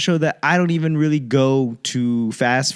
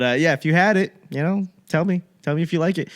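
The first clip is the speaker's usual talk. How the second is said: ordinary talk, nothing marked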